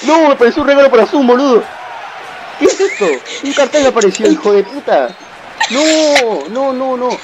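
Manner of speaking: very high, outraged cry; outraged disbelief. Real, not acted. Gamer robbed by a Zoom pop-up mid-play, outraged. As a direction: protest in high-pitched disbelief. Screen notice interrupts his play.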